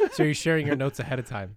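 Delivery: laughing through the line. Real, not acted. Co-host laughs at the early summary, laughing. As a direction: tease him while laughing.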